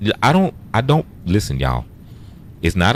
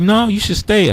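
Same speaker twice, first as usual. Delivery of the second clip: high mock plea. Quoting the DJ urging him.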